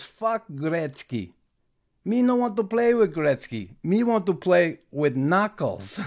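hammed-up Slovak rookie refusing the trade; broken-English outburst. Igor refusing to join Gretzky, loud defiant broken English. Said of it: "he goes".

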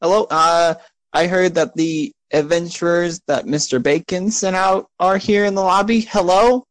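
put-on high, questioning small-boy voice; little boy. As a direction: call out high, like a boy looking for someone. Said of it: "a little boy".

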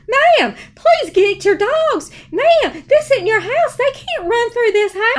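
loud, high-pitched plea to a client; hammed-up frantic shouting; exasperated calling out.

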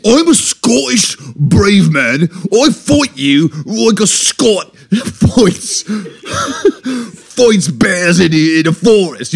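loud, slow hammed-up Scottish accent. Shown as a demonstration. Brave Scotsman boasting of fights, badly faked Scottish accent. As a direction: boast like a brave Scot, thick accent breaking into laughter. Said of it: "Okay, let's go Scottish".

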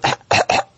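a short dry gag sound like a dog choking a bit; imitated gag cough. Shown as a demonstration. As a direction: cough it out like a gagging puppy. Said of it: "Your dog's gonna do a little".

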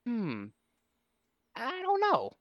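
put-on high, wavering old lady voice. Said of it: "She went".

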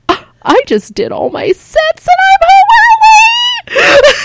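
high, loud, hammed-up crying whine; mock whining wail. Comedian calling her sponsor late at night, high hammed-up whining wail. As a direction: wail it like a tantrum, slowly. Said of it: "I said".

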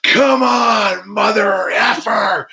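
high, strained yell, stretched at the end; defiant shout. Retold or imitated. Wrestler daring his opponent after the kick, re-enacted defiant shout. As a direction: re-enact a wrestler's furious challenge.

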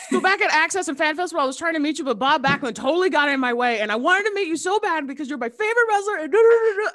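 high, loud breathless-fan babble; retold fan gushing. Young caller telling idol of missed meeting, excited gushing. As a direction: gush it all out in one high run.

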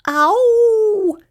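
long, high, loud "ow"; pained cry. A narrator's reading. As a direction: howl as if stung. Rat is stung.

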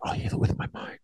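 very soft whispered imitation; mock mystical whisper. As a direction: whisper it like a would-be mind healer. Mimicking father's mind-healing claim.

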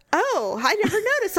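lightly voiced oblivious husband; mock clueless husband. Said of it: "And he goes".

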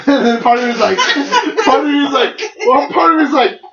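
high giggly voice; laughing. Real, not acted. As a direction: laugh through the words. Laughing while retelling.

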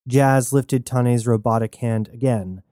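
usual speaking voice

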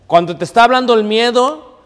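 ordinary delivery

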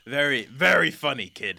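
loud mock amusement, falling away at the end; lightly voiced old lion humouring a youngster; amused old lion. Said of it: "he kinda goes".

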